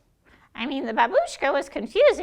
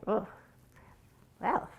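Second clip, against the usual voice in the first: ordinary talk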